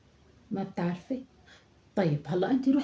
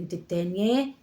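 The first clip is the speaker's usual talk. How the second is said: normal talking voice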